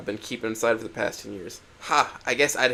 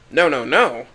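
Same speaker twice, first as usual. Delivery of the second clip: shouted "no, no, no" in rising panic; loud protest; 2 semitones higher, 10 dB louder, slower than usual. Acted. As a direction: cut her off with a loud denial. Inko's outburst in the argument.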